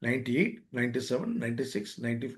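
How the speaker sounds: low, soft counting down; calming countdown. Shown as a demonstration. Advising someone on easing anger, low soft countdown. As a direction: count backwards softly and low. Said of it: "you count like this".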